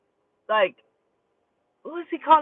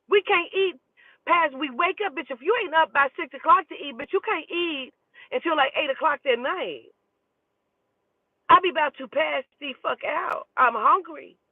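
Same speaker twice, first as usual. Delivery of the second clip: pitched-up frustrated venting, fast; exasperated rant; 6 semitones higher than usual. Real, not acted. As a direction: vent the hunger complaint with rising exasperation. Ranting about fasting rules.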